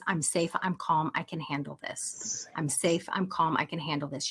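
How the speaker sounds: quiet repeated mantra; calm mantra. Shown as a demonstration. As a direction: say it to calm yourself. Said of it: "I call it the mama meditation".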